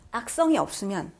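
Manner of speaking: ordinary talk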